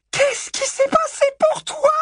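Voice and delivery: slow, piercingly high shriek; voix suraiguë. Acted. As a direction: screech so high only bats could hear. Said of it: "Sa voix était devenue si aiguë". (cri indigné, extrêmement aigu)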